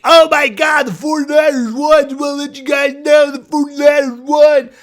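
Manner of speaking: high, loud gushing fan voice; hammed-up overexcited fan; mocking bandwagon fan. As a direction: shout it like a giddy new fan. Mocking excited new fans.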